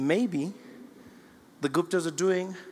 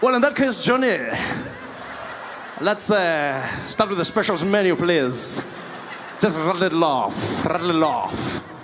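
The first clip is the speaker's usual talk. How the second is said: smug diner; 4 dB louder, slower than usual. Retold or imitated. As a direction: order him about, savouring the power. Diner lords it over the waiter.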